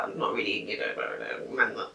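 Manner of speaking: low, quiet mumbled imitation of a man; mock boyfriend voice. Boyfriend reluctant to help out, in a low mock mumble. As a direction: mumble it deep and soft, imitating him. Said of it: "why am I even talking like that?".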